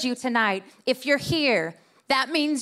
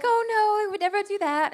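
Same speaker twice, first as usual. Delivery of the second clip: higher put-on innocent voice; fake polite voice; 8 semitones higher than usual. Stranger claiming she would never do that, in a higher, fake innocent voice. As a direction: play it overly innocent. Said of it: "You're being so fake right now".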